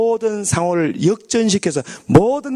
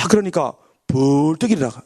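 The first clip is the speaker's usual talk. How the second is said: ordinary delivery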